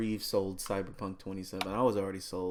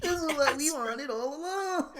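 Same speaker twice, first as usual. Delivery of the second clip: high squeaky SpongeBob voice; high cartoon voice. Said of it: "It's like".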